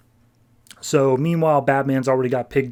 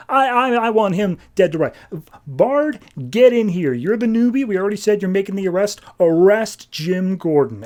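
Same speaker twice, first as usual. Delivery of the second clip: higher-pitched, emphatic mock commands; acted indignant boss, raised pitch; retold heated ordering; 6 semitones higher than usual. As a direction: demand the arrest insistently. Forbes angrily orders Gordon's arrest.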